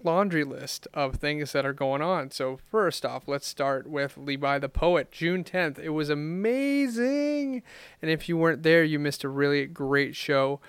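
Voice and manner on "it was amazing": slow, high, drawn-out amazing; excited. Real, not acted. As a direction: gush about the show. Excited recap of a show.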